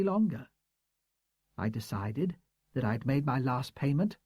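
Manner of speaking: a hint of a proud, hurt old teacher; woman's voice. A narrator's reading. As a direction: read her as a composed, defensive schoolteacher. Woman's voice in the story.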